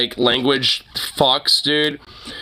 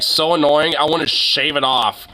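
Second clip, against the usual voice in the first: ordinary delivery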